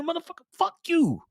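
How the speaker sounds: high, hammed-up snap back; mock angry retort. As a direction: fire back like an offended guy, half joking. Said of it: "you're like".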